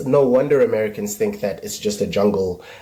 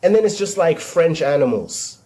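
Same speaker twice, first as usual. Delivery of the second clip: normal talking voice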